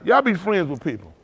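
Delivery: ordinary talk, nothing marked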